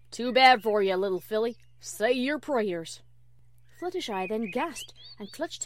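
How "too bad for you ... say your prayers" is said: loud, gloating villain growl; menacing pretend wolf. Applejack threatening Fluttershy as the wolf, loud menace. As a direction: threaten like a big bad wolf.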